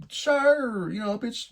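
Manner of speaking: high-pitched drawn-out sure; insincere agreement. Retold or imitated. Agreeing to date's ice cream stop, high put-on willingness.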